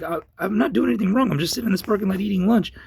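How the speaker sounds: high, retold plea of innocence; indignant protest. Man protesting to police while eating lunch, indignant. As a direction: insist on innocence, voice pitched right up.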